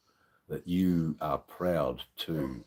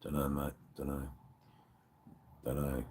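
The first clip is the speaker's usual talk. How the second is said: ordinary talk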